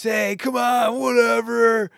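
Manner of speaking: high, loud jeering heckle; heckling. Retold or imitated. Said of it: "all this heckling".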